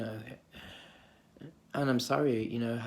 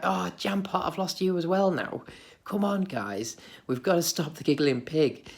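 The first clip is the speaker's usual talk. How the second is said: higher, laughing plea to the others; half-laughing call to stop the giggling; giggly pleading; 6 semitones higher than usual. Retold or imitated. Child begging Jam Pot to stop the giggling pig, giggly high plea. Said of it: "be like".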